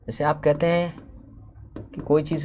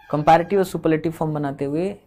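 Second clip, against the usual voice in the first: ordinary delivery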